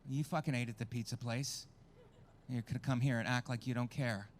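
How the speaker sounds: ordinary talk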